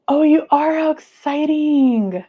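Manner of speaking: excited. Real, not acted. Viewer is going to skin removal.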